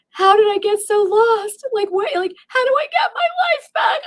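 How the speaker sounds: high, loud wail of panic; freaking out. Retold or imitated. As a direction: cry out in desperate panic. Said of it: "I was freaking out".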